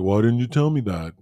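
loud indignant whine; indignant Rocky. Retold or imitated. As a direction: complain like a betrayed boxer. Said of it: "So Rocky's like".

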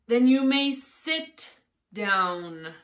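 very slow, loud decree; loud, deliberate royal command; commanding king. A narrator's reading. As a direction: draw out the order with authority. King curtly orders the Hatter.

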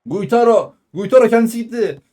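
ordinary talk

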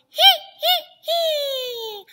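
very high, loud hee's buzzed through the nose; high nasal resonance hee hee hee. Shown as a demonstration. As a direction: ring three nasal hee's in the mask. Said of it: "Let's go".

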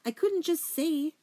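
high-pitched tearful rush of explanation; acted sob, pleading to be understood; sobbing. A narrator's reading. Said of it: "Poppy sobbed".

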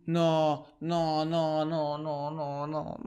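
droning string of noes; a monotone no-no-no, dying away; dismayed. Real, not acted. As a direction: fade out in stunned denial. Dismay at being unable to leave.

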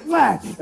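exaggerated kissing smack; noisy mock kiss. Acts out a forehead kiss.

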